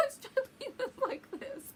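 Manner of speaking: squeaky high, slow laughter through the words; real giggling fit; can barely talk for laughing; laughing hard. Real, not acted. Laughing at retold ride scene.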